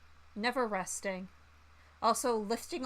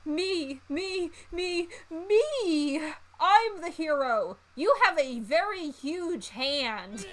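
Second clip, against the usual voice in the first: me me me, climbing higher and louder into a slow shout; from a raised voice to a full slow shout; building self-centred shout; 7 semitones higher, 6 dB louder, much slower than usual. Acted. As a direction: rise into a hammy hero's bellow. Self-centred tantrum, escalating.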